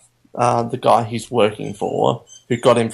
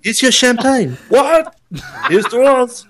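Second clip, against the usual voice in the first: high-pitched mock character exchange; playful, hammed-up high voice; acted movie dialogue; 10 semitones higher than usual. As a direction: act out the couple in a high voice. Acts out imagined dialogue with Jaws.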